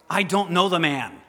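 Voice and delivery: loud cursed oath of denial; cursing denial. Acted. Peter denying Jesus with a loud cursing oath. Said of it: "starts to curse and to swear with an oath".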